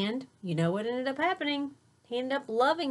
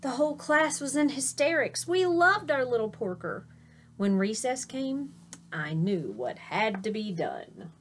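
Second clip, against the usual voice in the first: lively storybook read-aloud; reading to children. A narrator's reading. Teacher reading a picture book to her class, animated. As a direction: read it bright and animated for little listeners.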